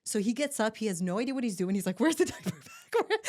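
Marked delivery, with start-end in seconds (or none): 2.0-3.2 high, slow flustered husband voice; panicked husband. Retold or imitated. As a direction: play the clueless husband in a panic.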